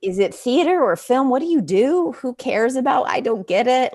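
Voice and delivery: a Southern farmer grandfather puzzled by his grandchild; grandfather quoted. Retold or imitated. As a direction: grandfather baffled by vegetarianism and theater. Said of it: "he was like".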